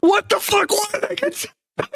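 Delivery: very high, squeaky mock disbelief; mock outraged player. Imitating the banned player's confused outrage, squeaky high. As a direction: squeal it like a clueless banned kid. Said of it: "he's gonna be like".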